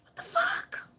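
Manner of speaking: high, quiet, squeaky exclamation; shocked squeak. Retold or imitated. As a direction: blurt it out in disbelief. Reacting while high, sudden exclamation.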